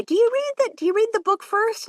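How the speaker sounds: put-on high voice of a naive asker; mock questioner. Narrator mimicking people who ask about pre-reading, mock high voice.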